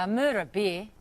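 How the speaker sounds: natural Australian, encouraging a copy; Australian accent demo. Shown as a demonstration. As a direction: repeat MURDER A BEER in native Australian. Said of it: "the phrase that you have to learn is".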